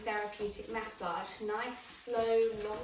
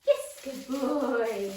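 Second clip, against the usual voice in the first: very slow, louder praise; drawn-out praise; 8 dB louder, much slower than usual. Real, not acted. Trainer rewarding puppy Rolo: slow, drawn-out praise. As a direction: stretch the praise out slowly for the dog.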